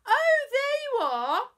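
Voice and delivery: very high, loud, slow mum's call; mother's sing-song welcome. Acted. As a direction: greet the child brightly like a fussy mother. Mum greets supposed Mabel.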